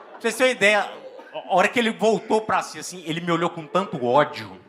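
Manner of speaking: normal talking voice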